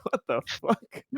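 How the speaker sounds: usual speaking voice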